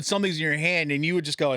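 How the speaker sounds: ordinary talk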